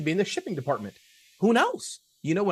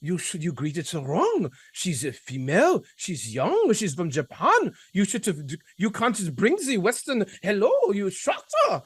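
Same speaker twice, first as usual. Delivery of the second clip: a hint of a French accent, slow and scolding; lightly voiced French boss correcting you; slower than usual. French boss scolding him for greeting wrong, lightly French accented. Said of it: "my French boss".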